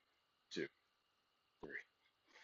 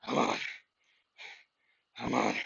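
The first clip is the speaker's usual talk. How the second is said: lifting weights. Real, not acted. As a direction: grunt it out on the rep. Grinding out the last reps.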